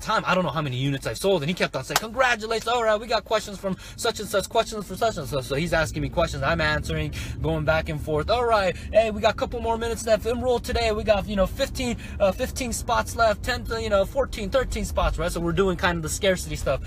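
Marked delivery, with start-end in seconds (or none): 2.0-5.4 high, singsong host patter; mock upbeat host voice; webinar host. Imitates host fielding questions. 8.3-15.2 high-pitched sales patter; mock upbeat closing pitch; webinar host. Imitates host's scarcity countdown.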